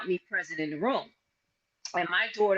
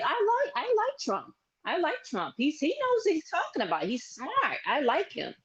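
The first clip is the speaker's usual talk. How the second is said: put-on much higher, eager young girl's voice; teen daughter voice; 9 semitones higher than usual. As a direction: perk up into a fifteen-year-old declaring her views. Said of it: "She was like".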